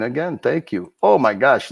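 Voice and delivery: ordinary talk, nothing marked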